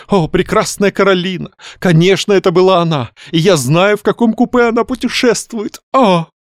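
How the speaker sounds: high, exalted wailing praise; lamenting rapturously. A narrator's reading. Young man raves about beautiful Каролина, lamenting rapturously. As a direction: rhapsodise in a high, carried-away voice. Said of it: "оставив того причитать".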